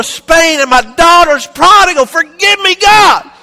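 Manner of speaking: from plain pleading up to a very loud, high cry; fervent prayer, building. Acted. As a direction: build the prayer into a desperate preacher's shout.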